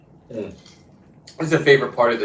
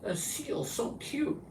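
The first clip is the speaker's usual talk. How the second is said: mock cutesy whisper; 14 dB quieter, slower than usual. Mocking seal lovers.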